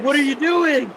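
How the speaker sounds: high-pitched mock disbelief; Paul Heyman. Mimicking a wrestling manager watching the clip, high mock disbelief. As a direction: exclaim it high, like an appalled manager. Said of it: "Paul Heyman's like".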